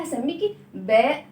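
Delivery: put-on slow, drawn-out naming for children; slow modeled naming. Shown as a demonstration. Teacher to children, slowly modeling the name. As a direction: say each sound slowly for the class. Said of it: "هتسميها إيه يا ولاد".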